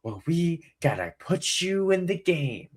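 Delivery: high, slow host imitation; imitated TV host making a drawn-out pitch; Jeff Probst. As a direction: stretch the words into a big mock invitation. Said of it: "Jeff Probst is like".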